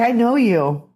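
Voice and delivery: thrilled I know you; excited recognition. Retold or imitated. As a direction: say it like you just realised who it is. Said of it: "They say".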